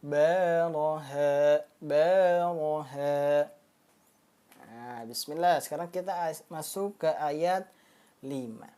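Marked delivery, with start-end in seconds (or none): 0.0-3.5 Baraha recited slowly, the long vowel held two beats. Shown as a demonstration. Teacher modeling correct vowel length for students. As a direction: hold baraha for exactly two counts. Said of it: "kaidahnya dua harokat".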